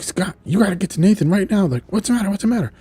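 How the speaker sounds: high-pitched, pressing urgency; urgent alarm. Retold or imitated. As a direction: send someone off at once. Urgent warning and alarmed reply.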